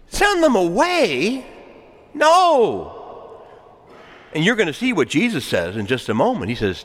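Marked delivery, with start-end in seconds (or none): 0.1-1.4 high, drawn-out disbelief; slow, high, rising challenge; incredulous question. Real, not acted. Preacher challenges the quoted dismissal. 2.1-3.4 high, shouted rejection; long, falling, high-pitched shout; emphatic refusal. Real, not acted. Preacher rejects sending people away.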